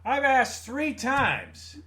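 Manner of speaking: put-on loud, slow, high old man demanding help; impatient old man. Old man in the display calling to staff, loud and slow. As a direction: drag it out like a fed-up old customer. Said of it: "old man just sitting there going".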